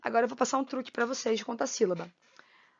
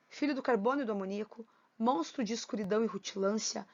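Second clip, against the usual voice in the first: poem recitation; 4 semitones lower, faster than usual. Acted. Teacher reading Augusto dos Anjos poem to class, lightly recited. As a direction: read the verse steadily, lightly dramatic.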